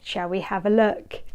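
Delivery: ordinary delivery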